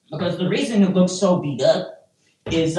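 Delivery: usual speaking voice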